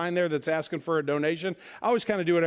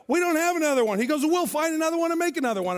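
animated back-and-forth; 10 semitones higher, 4 dB louder, faster than usual. Retold or imitated. Buddy and holder argue about sign.

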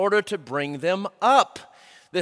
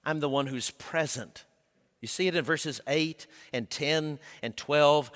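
ordinary delivery

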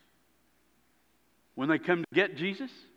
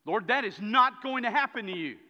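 higher, louder acted protest; emphatic protest; 7 semitones higher, 6 dB louder than usual. Peter protesting to his Lord, emphatic and insistent. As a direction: blurt it out as a forceful objection.

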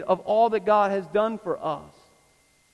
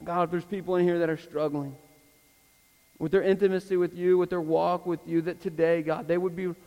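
public prayer. Real, not acted. Pastor asks God to grow the congregation, earnest closing prayer. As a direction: pray it out phrase by phrase, with long pauses.

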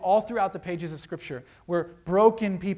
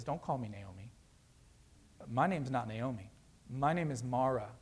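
bitter, low; 5 semitones lower, 7 dB quieter than usual. Retold or imitated. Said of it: "she stops her and says".

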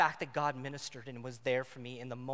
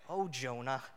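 fond sigh; 2 semitones lower than usual. Shown as a demonstration. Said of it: "That's how I say his name".

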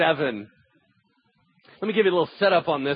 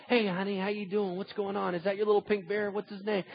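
put-on much softer, gentle words to a small child; soft gentle hush; 3 semitones higher, 6 dB quieter than usual. Shown as a demonstration. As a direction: coax her quietly, like not to startle her. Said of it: "you gotta whisper real quiet".

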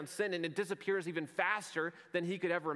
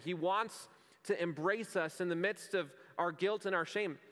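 ordinary talk

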